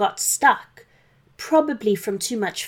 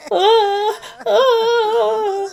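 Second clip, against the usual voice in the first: much higher pained cries; reindeer crying out; 13 semitones higher, 7 dB louder than usual. Acted. Rudolph burnt by dragon fire, crying out high. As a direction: yelp high and loud in pain. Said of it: "Santa could hear Rudolph crying".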